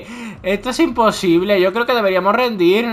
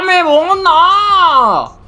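"me bota" stretched very slow into a much higher, louder crying shout; anguished wail; 9 semitones higher, 5 dB louder, much slower than usual. Real, not acted. Gamer howls to his friends as the buggy keeps bouncing, anguished wail. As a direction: drag "me bota" out into a despairing howl.

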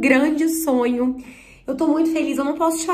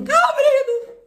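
sudden high-pitched squeak; excited squeal; 14 semitones higher, 4 dB louder than usual. Real, not acted. Creator sees the package finally opening, excited squeal. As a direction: squeal as it starts to give.

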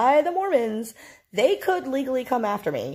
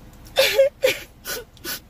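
high wailing fake sob, hammed up; a hammed-up boo-hoo wail; mock sobbing; 5 dB louder than usual. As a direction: pretend to bawl like a faker. Mocking the roommate's fake crying.

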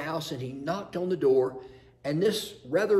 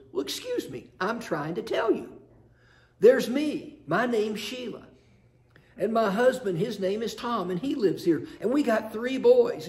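higher, exasperated woman explaining herself; a put-upon wife insisting she's answering; indignant Sheila; 5 semitones higher than usual. Acted. Sheila insisting to the census taker, higher and indignant.

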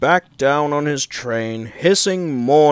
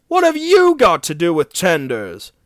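louder, higher incredulous question; mildly scornful surprise; exclaiming; 5 semitones higher, 5 dB louder than usual. A narrator's reading. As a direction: challenge it in disbelief. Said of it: "exclaimed Henry".

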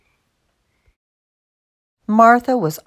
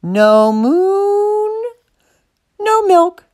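high, long-drawn MOON, then sharp NO MILK; stubborn demand; 11 semitones higher, much slower than usual. A narrator's reading. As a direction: insist on it, high and stretched out. Martha repeats her stubborn refrain.

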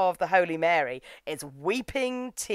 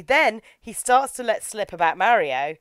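ordinary talk

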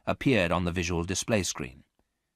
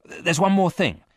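higher, louder hurried addition; quick interjection; 11 semitones higher, 7 dB louder than usual. A narrator's reading. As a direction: jump in quickly. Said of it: "added Varon quickly".

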